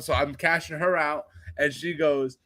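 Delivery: ordinary talk, nothing marked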